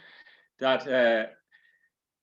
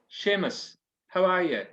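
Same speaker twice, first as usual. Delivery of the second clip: parish priest; 7 semitones higher than usual. Acted. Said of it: "says the priest".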